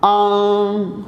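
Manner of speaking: آنگ said as "aang" with a long open "aa"; ang sound. Shown as a demonstration. Teacher modelling the vowel for students, long "aa" in آنگ. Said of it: "اب یہ کیا بن جائے گا".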